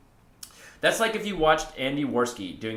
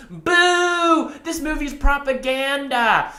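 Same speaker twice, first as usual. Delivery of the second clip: very high, loud drawn-out boo; imitated heckling yell; 14 semitones higher, 6 dB louder, much slower than usual. Mimicking a moviegoer booing the film, high heckling yell. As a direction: heckle the screen in a high, slow mock yell. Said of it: "they're just yelling".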